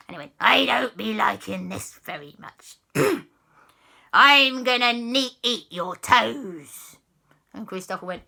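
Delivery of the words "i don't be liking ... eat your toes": slow, drawn-out pirate threat; menacing pirate ghost. Acted. Pirate ghost threatens to eat toes, comic menace. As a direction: stretch the threat out theatrically.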